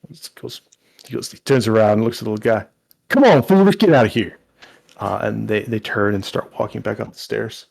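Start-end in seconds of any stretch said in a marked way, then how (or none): 3.1-4.4 loud, higher, bossy elf command; gruff order. Acted. Bulky elf ordering his goblin sidekick out, loud and gruff. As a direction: bark at the little guy to leave.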